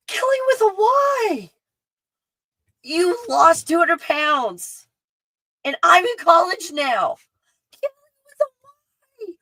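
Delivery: very high, drawn-out squeal of joy; thrilled, high-pitched exclaiming; overexcited. Real, not acted. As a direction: squeal in amazed delight, stretching the words. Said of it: "to be all excited about this".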